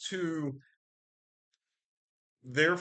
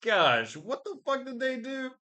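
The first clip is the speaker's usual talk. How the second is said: shocked disbelief; 6 semitones higher than usual. Retold or imitated. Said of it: "It was just like".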